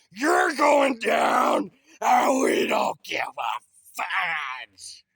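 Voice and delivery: slow booming shout at the shack; unhinged villain. Acted. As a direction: roar it like a maniac. Demolition hype, yelling at shack.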